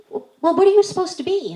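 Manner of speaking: hesitant grown-up asking, high; high homeowner voice. Retold or imitated. Homeowner asking the trick-or-treater what he is, high and stammering.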